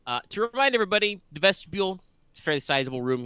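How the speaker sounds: high, quick emphatic game master voice; scene-setting GM. Acted. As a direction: set the scene with energy.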